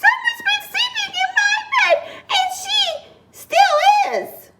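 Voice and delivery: very high, squeaky little bear; tiny high-pitched bear voice; Baby Bear. A narrator's reading. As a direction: squeal it like a startled cub. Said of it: "squeaked in his little tiny voice".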